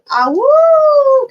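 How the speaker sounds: high loud playful wolf howl; howling like a wolf pup. Pretend. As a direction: throw your head back and howl. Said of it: "Can I get another awoo?".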